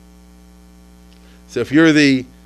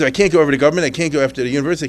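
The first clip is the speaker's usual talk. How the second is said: usual speaking voice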